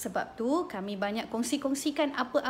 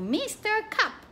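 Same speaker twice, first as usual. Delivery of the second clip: a cup character in a high, playful storytelling voice; Mister Cup voice; 9 semitones higher, slower than usual. Shown as a demonstration. Mother voicing a cup toy for her baby, high and playful. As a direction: play a talking cup for the baby, pitched high. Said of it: "boleh buat cerita guna cawan tu".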